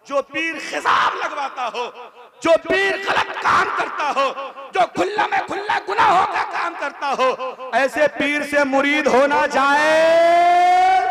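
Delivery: building to a loud high drawn-out peak; preaching climax. Acted. (जोश बढ़ाते हुए ऊँची तकरीर)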